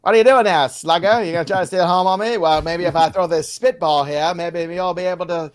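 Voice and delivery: put-on loud, high old-timey pitcher taunt; old-timey ballplayer voice. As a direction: jeer like a 1920s pitcher.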